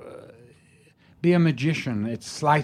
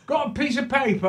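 put-on cockney drunk; 13 semitones higher than usual. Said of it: "He goes".

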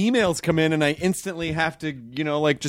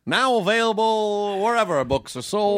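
announcer voice; 4 semitones higher than usual. Acted. Plugging the guest's book.

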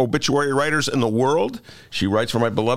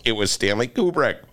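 ordinary delivery

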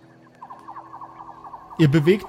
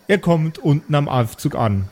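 ordinary talk

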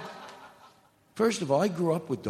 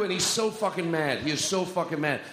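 usual speaking voice

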